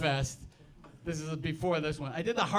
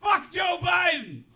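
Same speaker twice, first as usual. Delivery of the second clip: loud, high, drawn-out shout; imitating a heckler hollering high over the crowd; heckler's yell; 12 semitones higher, 6 dB louder, slower than usual. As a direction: yell it out slowly like a random heckler. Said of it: "this guy yells up".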